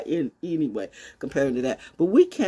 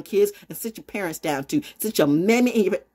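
ordinary delivery

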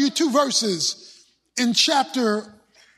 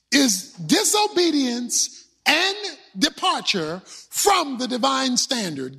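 normal talking voice

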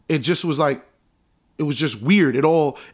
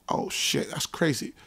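whispered, much softer mock reaction; hushed aside; 10 dB quieter, slower than usual. Hearing [name]'s theft claim, a whispered stunned aside. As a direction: breathe it out under your breath.

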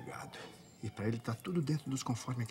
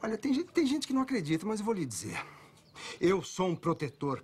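insistent boast; 10 semitones higher, 4 dB louder than usual. Acted. Pimp boasting, insisting loudly.

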